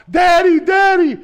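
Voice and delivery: high, loud imitated kids' scream of Daddy; kids screaming. Retelling the kids calling their father, high imitated scream. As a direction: shriek like a panicked child.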